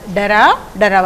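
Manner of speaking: ordinary talk, nothing marked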